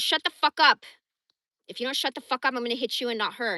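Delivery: husband shouting a threat at his wife; imitated angry husband. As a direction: bark it like a raging husband. Voicing enraged husband.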